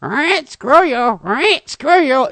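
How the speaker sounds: high, loud squawking parrot curse; a parrot cursing him out mid-fight; swearing parrot. Retold or imitated. Retold parrot cursing the narrator, high and loud. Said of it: "it's cursing me out".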